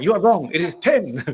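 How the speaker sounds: imitating a cross French principal; angry French accent. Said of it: "she got angry at me".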